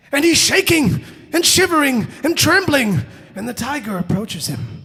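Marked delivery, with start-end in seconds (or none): 0.1-3.0 high, loud, emphatic storytelling; dramatic terror narration. A narrator's reading. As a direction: hammer each verb with alarm.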